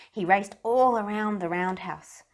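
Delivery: plain reading voice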